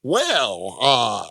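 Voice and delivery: a hint of a Joker-like drawl; lightly voiced villain impression. Friend tries a long-avoided impression, hint of the Joker. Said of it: "This sounds like the Joker".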